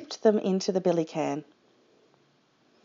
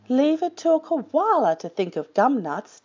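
higher, lightly amused storybook voice; 5 semitones higher than usual. A narrator's reading. Dingo teasing the koala, amused. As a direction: sound tickled, without laughing. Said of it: "Dingo laughed".

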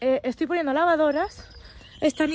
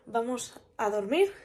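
normal talking voice

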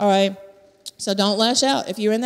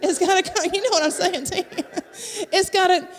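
laughing; 6 semitones higher than usual. Real, not acted.

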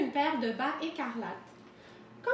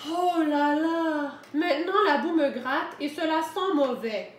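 sighing complaint; 3 semitones higher, 5 dB louder than usual. A narrator's reading. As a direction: lament it like a fed-up storybook wolf. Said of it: "et soupira". (soupir plaintif et exagéré)

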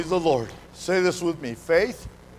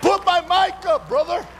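full-force preaching; 9 semitones higher than usual. Acted. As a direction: whip the church up, shouting. Preaching climax with shouts.

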